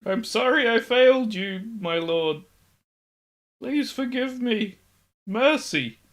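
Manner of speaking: high, pleading terrified servant; begging for mercy. Acted. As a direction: grovel for your life. Servant begs the lord again.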